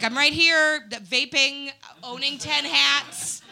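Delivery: mock-insistent loud reply; calling back. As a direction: call out to be noticed, slowing at the end. Calling back to searching men.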